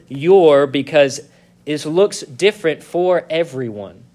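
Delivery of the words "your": "your" said louder and set apart; a firm, loud "your"; stress on "your". Shown as a demonstration. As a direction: punch "your" as the key word. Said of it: "I emphasize that word".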